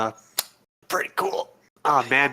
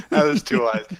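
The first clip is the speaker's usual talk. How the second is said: ordinary talk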